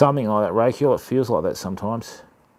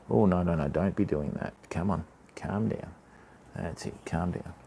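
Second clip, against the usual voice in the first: soothing calm down; 6 semitones lower, 9 dB quieter than usual. Real, not acted. Settling a fish or pet.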